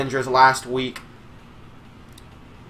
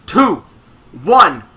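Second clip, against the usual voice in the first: ring announcer; 5 semitones higher, 6 dB louder than usual. Acted. Countdown then announcing the entrants.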